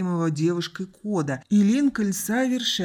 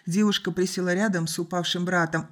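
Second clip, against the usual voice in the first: ordinary delivery, nothing marked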